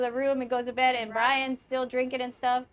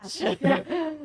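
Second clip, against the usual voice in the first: put-on laughing drunk slur; 5 semitones higher than usual. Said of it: "how we all pronounce your name when we're drunk".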